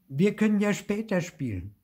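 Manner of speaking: high-voiced child offering a compromise; apologetic child. A narrator's reading. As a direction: put them off apologetically. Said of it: "sagt er schnell und schaut Theo entschuldigend an".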